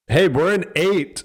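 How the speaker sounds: a mild yell across the distance; calling back. Acted. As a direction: answer from far off without booming. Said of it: "he's not booming his voice".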